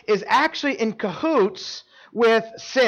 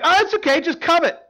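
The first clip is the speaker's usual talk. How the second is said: coaxing sin; 7 semitones higher than usual. Pretend. Plays sin coaxing someone.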